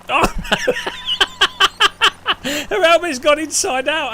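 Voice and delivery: hoher, lachender Ausruf; laughing hard. Real, not acted. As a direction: kaum sprechen vor Lachen. Laughing at absurd helicopter pilot.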